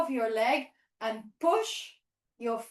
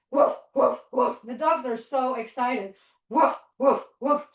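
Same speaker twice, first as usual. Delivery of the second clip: lower, louder woofs; low playful barks; 4 semitones lower, 3 dB louder than usual. Pretend. Teacher barking as a dog for the kids, low and loud. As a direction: bark like a big dog.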